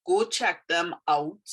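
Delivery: normal talking voice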